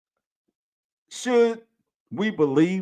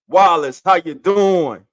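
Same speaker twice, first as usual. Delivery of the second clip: hype greeting; 4 semitones higher, 9 dB louder than usual. Real, not acted. Host welcomes his big brother to the show, loud hype call.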